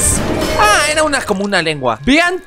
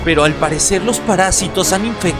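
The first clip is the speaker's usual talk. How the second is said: narrator voice; 6 semitones lower than usual. Acted. Reads game intro as narrator.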